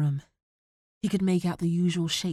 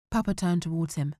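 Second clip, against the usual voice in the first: plain reading voice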